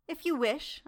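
lightly demure reply, pitch lifted; a coy, modest "if you wish". A narrator's reading. Mina answering the doctor, teasing him demurely. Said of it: "as demurely as I could".

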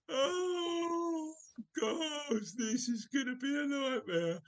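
high, exasperated groan of words. Real, not acted. Host to his players as improv derails, exasperated. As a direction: despair of them in a high voice.

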